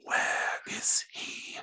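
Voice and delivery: slow eerie whisper; tiny voice. Acted. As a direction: breathe it out like a tiny creature. The egg creature speaks again.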